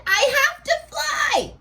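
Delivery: sudden rush to leave before midnight; urgent Cinderella. Acted. Cinderella seeing the clock and calling out to the prince, high urgent cry. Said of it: "She said".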